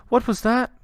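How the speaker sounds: frightened mother at the table; startled mother. Acted. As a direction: jump at a sudden scream.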